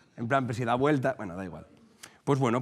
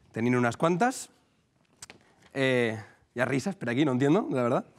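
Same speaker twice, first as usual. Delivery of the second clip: usual speaking voice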